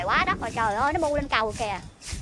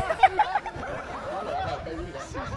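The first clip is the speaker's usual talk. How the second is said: burst of laughter; 7 dB quieter than usual. Real, not acted. Laughing at the cursing monkey.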